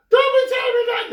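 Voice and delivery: mock girlfriend firing back high and fast; girlfriend snapping. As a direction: rattle it off high like an upset girlfriend.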